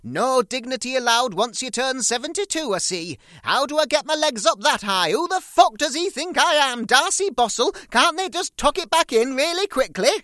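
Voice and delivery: very high, loud old-mother impression; shrill-free screech of an old mum complaining; loud hammed-up old mother voice; mother moaning. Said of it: "she moaned on".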